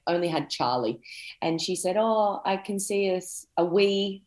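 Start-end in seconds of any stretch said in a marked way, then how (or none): 2.0-4.2 slow, imitated Scottish lilt from the psychic friend; a light Scottish accent, drawn out. Psychic friend predicting a baby, in a mock Scottish accent. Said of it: "'cause she's, she's Scottish".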